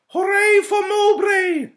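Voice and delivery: high, loud, slow creepy cheer; creepy knight yelling. A narrator's reading. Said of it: "yelled one particularly creepy, crawly knight".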